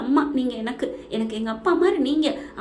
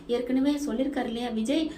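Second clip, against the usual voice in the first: ordinary delivery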